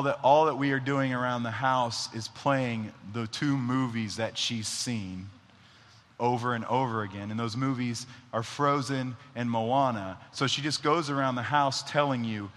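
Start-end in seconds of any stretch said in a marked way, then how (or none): none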